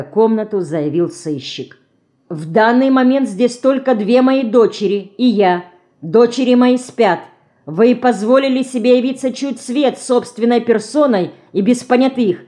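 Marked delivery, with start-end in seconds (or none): 2.3-12.4 indignant. A narrator's reading. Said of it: "возмутилась Марина".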